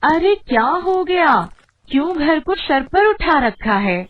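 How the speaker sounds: loud, high exasperated retort; annoyed scolding. Acted. Wife answering her husband's shouting, annoyed scolding. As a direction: scold him loudly for the racket.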